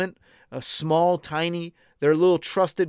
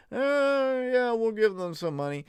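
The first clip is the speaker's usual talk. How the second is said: put-on grudging voice, slightly higher; mock politicians; 8 semitones higher than usual. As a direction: lightly imitate reluctant millionaires. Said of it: "they're all saying".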